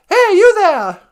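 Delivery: loud, high hail to someone below; calling out. Acted. Scrooge hails a boy from his window, calling out. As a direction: shout it down to the street.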